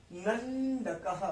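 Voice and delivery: slow "नंदकः" with the first syllable "नन" held on a long n; drawn-out half na. Shown as a demonstration. Teacher showing students the half na, "नंदकः" said slowly with a held n. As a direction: hold the n in "नंदकः", then release "दकः". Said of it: "अन आएगा".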